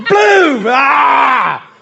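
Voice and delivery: high, loud mock gunshot and cry; loud laughing boom then ah; shouted gunshot boom. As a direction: blast it out like a shotgun. Imitated gunshot.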